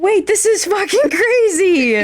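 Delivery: high, slow, breathless delight at the gift; real thrilled surprise. Real, not acted. Fan receiving a signed poster, overjoyed. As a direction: stretch it out, overjoyed and stunned.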